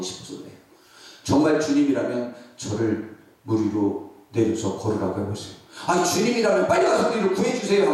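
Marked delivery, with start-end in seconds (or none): 5.9-7.9 imitating a panicked disciple. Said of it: "하면 되지".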